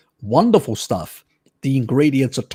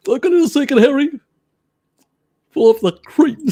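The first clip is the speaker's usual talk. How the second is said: teasing call-out; 11 semitones higher, faster than usual. Acted. Teasing Harry on stream.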